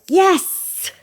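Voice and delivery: high, loud burst of triumph; excited yes. Shown as a demonstration. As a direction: shout it like you just won. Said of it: "that feeling when you're like".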